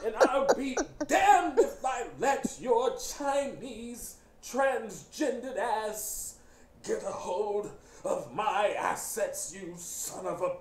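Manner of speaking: slow, strained corporate villain; Mister Price. Retold or imitated. Imitating the evil executive's threat, slow strained voice. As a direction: labor over every word, like having a stroke. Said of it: "he talks like that".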